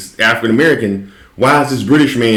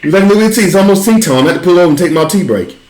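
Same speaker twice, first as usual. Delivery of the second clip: high hammed-up British tea talk; mock British accent; 7 semitones higher, faster than usual. A fake British driver insisting on tea break, in a mock British accent. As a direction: play a fussy Brit craving tea. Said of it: "British accent".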